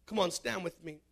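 ordinary talk

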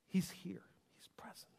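hushed, unvoiced whisper. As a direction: whisper it as if in awe. Whispering God's presence.

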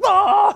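sudden loud, high mock yell; acted-out high holler; startling shout. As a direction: burst out "Ah!" like a surprise apparition. Imagined angel popping up at communion.

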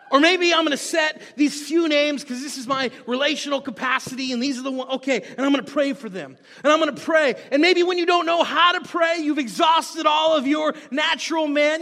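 loud, driving pulpit delivery; fervent preaching. Real, not acted. Preacher urging people to pray for names, fervently. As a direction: push it out to the congregation.

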